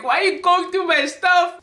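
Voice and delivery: high-pitched, held-back protest; upset, not screaming. Retold or imitated. Daughter who can't scream at her parent, upset. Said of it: "I wasn't screaming, but I was just like".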